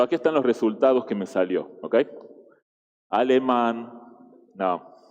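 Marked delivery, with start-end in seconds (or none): none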